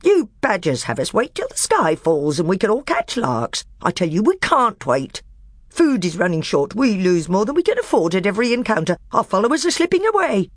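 high, loud, heaping insults; scornful dwarf. A narrator's reading. Nickabrick mocking the magician in open anger. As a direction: jab each name at him.

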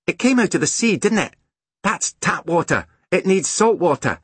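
put-on high, know-all child's voice; exasperated small boy. A narrator's reading. Small boy pointing out the mistake to sister, exasperated. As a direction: explain the obvious in a high boy's voice. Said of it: "Angus sighed".

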